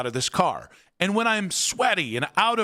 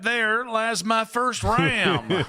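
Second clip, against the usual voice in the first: slow, hammed-up Southern country drawl, higher; a Southern old-timer proudly naming his first Ram; mock Southern drawl; 6 semitones higher, much slower than usual. A Southern drawl mourning the old truck, hammed up. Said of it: "I don't know why they have a Southern accent".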